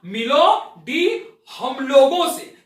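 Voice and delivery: "मिलो डी हम लोगों से" drawn out slowly, each word apart; slow, deliberate. Shown as a demonstration. Teacher slowly repeating the pun line for students. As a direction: spell it out slowly for the class. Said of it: "मैंने क्या बोला?".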